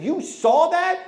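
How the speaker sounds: high, loud, slow disbelief; astonished. Retold or imitated. Speaker stunned on the phone with the pastor, high and slow. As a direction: stretch it out in shock.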